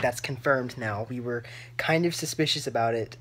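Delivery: ordinary delivery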